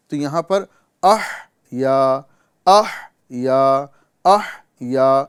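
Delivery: "اح یا" split slowly into two parts; clear ah-ya. Shown as a demonstration. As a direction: draw out "اح" then land on "یا". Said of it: "اس طرح ادا کریں گے".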